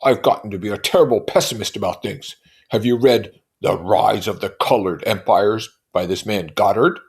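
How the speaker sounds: loudly voiced Tom; Tom's voice. A narrator's reading. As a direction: lecture like a brute. Tom voiced in read novel.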